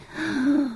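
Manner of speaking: high, soft gasp; exhausted gasp. Acted. Creature collapsing after a wish, spent gasp. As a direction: let the air out, spent. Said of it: "blew itself out, collapsed".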